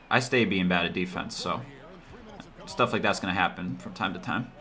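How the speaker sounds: ordinary delivery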